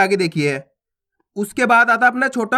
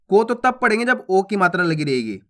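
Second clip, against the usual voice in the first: ordinary delivery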